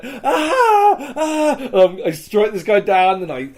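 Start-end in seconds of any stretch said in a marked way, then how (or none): 0.0-1.6 two high, loud retold battle screams; screaming. Player re-enacting the fight for a friend, screaming. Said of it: "I'm just screaming like".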